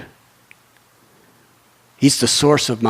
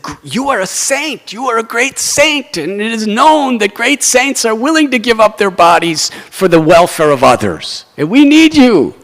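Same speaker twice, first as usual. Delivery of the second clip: high, insistent exhortation, ending loud; preaching plea; 9 semitones higher, 4 dB louder than usual. Retold or imitated. Demigods urging a saint to give his body, preaching. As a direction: press the plea in a raised pitch. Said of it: "the demigods preached to him".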